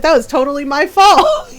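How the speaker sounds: laughing through the words as dad; chuckling apology in dad's voice; a laughing, easygoing dad. Retold or imitated. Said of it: "he's like".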